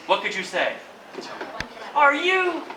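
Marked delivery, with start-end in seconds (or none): 1.9-2.6 high, startled gasp of a question; surprised. Shown as a demonstration. Teacher acting out a lottery win, stunned. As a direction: cut off in shock mid-question.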